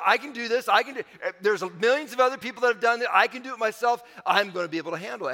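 a preacher acting out the jump, gaining nerve; confident self-talk. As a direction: pump yourself up. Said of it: "I've talked myself into courage".

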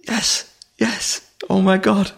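imitating tearful joy. Hecla in tears seeing the baby saved, imitated high and tearful. Tearful colleague voiced in story.

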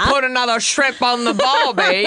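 a lightly put-on Australian accent; joking Australian accent, barely there; bad Australian accent. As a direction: fumble a mock Australian accent. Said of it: "I cannot do an Australian accent".

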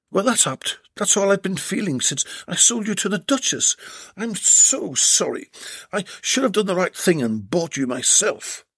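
a regretful confession; Jed's voice. Acted.

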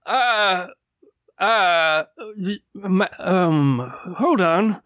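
lightly voiced fumbling old man; stammer, lost for a name; a hint of a befuddled old man; confused old man. Old man forgets his name.

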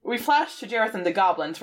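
ordinary talk

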